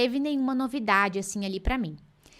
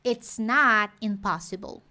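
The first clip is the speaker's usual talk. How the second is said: "not" held long and slow in "It's not impossible."; teacher's slow model stressing not; much slower than usual. Shown as a demonstration. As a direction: lean on "not", slowly. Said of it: "Então, aqui".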